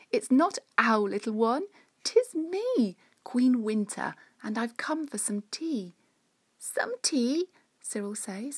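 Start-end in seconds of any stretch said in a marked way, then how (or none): 0.1-5.9 higher, louder regal queen voice; grand and gracious winter queen. Acted. 6.6-7.5 high breathless puzzled question; baffled little squeak; surprised and confused. Acted. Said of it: "surprised and confused".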